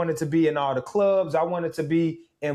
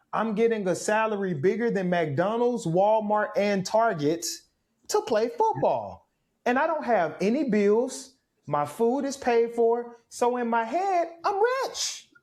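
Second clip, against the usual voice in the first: building from raised pitch to a squeaky high "I'm rich"; mounting excitement; 6 semitones higher than usual. Retold or imitated. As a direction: gush over the salary, climbing higher until it cracks. First salary, thinking he's rich.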